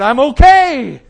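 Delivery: loud, high, slow triumphant shout; slow, loud high retold shout; joyful shout. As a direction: shout it out of pure relief. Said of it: "And I shouted".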